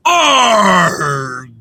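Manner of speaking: loud, rough pirate "Arg"; pirate growl. Pretend. Rehearsing pirate talk for the listeners, loud growl. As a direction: bellow it like a pirate captain. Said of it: "Slightly better".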